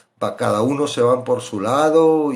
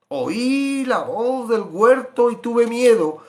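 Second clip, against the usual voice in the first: Adam's answer; 9 semitones higher, 5 dB louder, much slower than usual. Acted. Voicing Adam's frightened answer.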